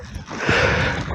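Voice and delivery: a quiet, breathy chuckle at himself; soft breathy laugh. Real, not acted. Hiker laughing at his own breathlessness, soft breathy chuckle. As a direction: let out a little airy laugh.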